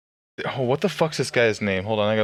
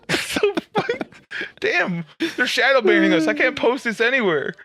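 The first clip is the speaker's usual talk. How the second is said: high excited rant; 12 semitones higher than usual. Acted. Mock complaint about shadow banning.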